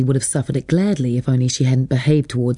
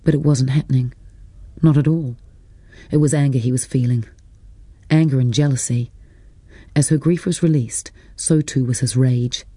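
plain reading voice

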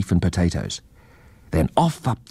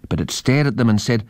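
usual speaking voice

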